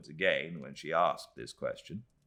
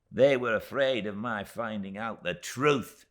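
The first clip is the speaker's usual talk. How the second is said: raised, urgent voice; exclaiming; 5 semitones higher, 6 dB louder than usual. Acted. Miss Moucher exclaiming to David, heated. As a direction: declare it with heat. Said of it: "exclaimed Miss Moucher".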